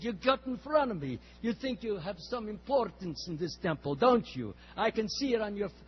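put-on loud, accusing Russian accent. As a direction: jab at him in a Russian accent. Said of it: "strong Russian accent".

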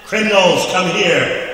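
loud high hammed-up summons; mock cop order. Railroad cop calling the boys over, loud mock order. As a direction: bark it like a bossy cop.

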